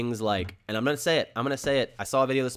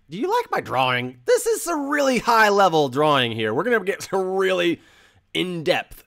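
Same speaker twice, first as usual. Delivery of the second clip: high, loud, hammed-up boast about the drawing; mock pride; 6 semitones higher, 5 dB louder than usual. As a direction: show off the drawing like it's a masterpiece.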